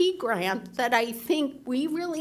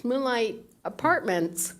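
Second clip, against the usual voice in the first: normal talking voice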